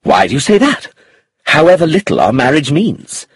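horrified echo. A narrator's reading. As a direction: throw her words back, appalled. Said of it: "He stared at her in horror".

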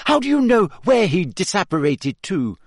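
high-pitched challenging comeback; pointed, indignant questioning; sharp retort. A narrator's reading. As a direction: throw the question back at him. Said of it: "Hermione shot back".

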